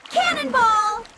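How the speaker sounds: high playful yell; cannonball yell. Acted. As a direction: shout it mid-jump. Jumping into the new pond.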